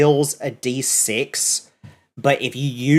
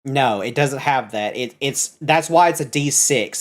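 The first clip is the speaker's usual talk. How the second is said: usual speaking voice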